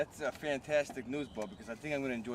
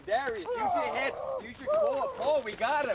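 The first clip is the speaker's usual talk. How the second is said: very high, loud coaching cry; straining coach yell; 5 dB louder than usual. Acted. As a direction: bark instructions during the tug. Coaching the pull.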